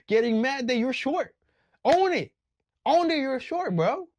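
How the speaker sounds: loud, high, urgent command; shouted scolding. Real, not acted. Scolding short men, loud high shout. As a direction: yell it at the men.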